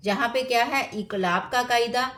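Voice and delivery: normal talking voice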